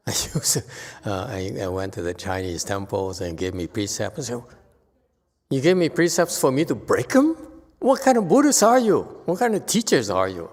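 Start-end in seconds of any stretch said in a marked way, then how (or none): 5.5-10.5 retold protest, rising high and loud; indignant outburst. Teacher retelling his protest to Chinese temple monks, indignant and loud. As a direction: hurl the complaint back at the temple teachers. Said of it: "I was so upset".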